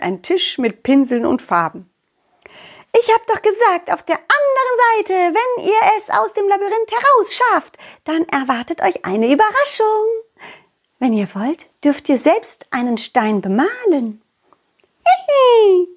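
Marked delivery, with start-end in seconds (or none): 3.0-14.2 put-on higher, bright little girl voice explaining; a friendly little butterfly girl telling the kids about the surprise. Butterfly girl telling the children about the stone surprise, higher little voice. 15.0-15.9 high giggle. Acted. Dog companion giggles.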